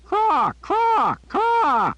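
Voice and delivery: loud, high raven caws in a row; three ear-splitting raven shrieks; three piercing caws. A narrator's reading. Raven cawing so loud Jillian covers her ears. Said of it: "three piercing caws".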